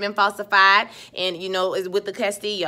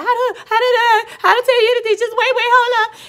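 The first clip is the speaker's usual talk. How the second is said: very high, loud strangled pleading; mock choked panic; 7 dB louder than usual. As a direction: squeal frantically as if being choked, hammed up. Acting choked woman pleading.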